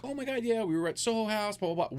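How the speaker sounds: high-pitched imitation of chatty small talk; mock gushing. Ignored man mimicking his date chatting to friends, mock gushing. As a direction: parody her breezy catching-up.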